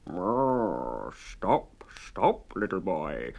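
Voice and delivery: low mooing call; mooing cow. A narrator's reading. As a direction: moo the words like a hungry cow. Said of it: "mooed the cow".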